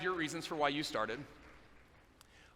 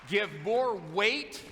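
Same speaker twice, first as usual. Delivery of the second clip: higher and louder, very slow; weighty emphasis; 5 semitones higher, 4 dB louder, much slower than usual. Real, not acted. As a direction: lean hard on every word. Stressing the key advice.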